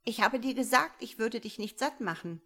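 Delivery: high, anxious opening easing off; frightened protest. A narrator's reading. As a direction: protest in alarm, loud at first. Pinned bird pleads with puma.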